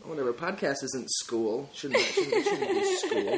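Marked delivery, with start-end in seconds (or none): 1.7-3.4 laughing through a covered mouth; muffled behind a hand; hand over mouth. Real, not acted. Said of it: "I shouldn't cover my mouth".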